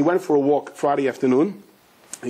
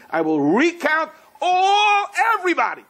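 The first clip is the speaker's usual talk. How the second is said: emphatic proclamation; 13 semitones higher than usual. Acted. God promising to recount everyone, emphatic high proclamation. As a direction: stretch it out, pitched way up.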